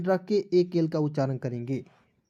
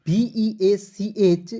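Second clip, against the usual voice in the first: B E A C H spelled out slowly; careful classroom spelling; much slower than usual. Shown as a demonstration. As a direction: spell it slowly so nobody misreads. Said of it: "बेच नहीं पढ़ लीजिएगा गलती से।".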